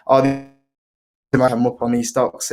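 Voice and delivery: ordinary delivery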